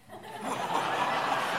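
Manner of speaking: breathy burst of laughter. Real, not acted. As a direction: laugh at the memory. Laughing after the punchline.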